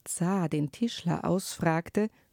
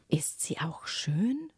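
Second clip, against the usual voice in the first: slow hushed whisper; curious whisper; slower than usual. Acted. Prince whispers to the old woman, slow and hushed. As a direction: breathe the question, half-daring to hope.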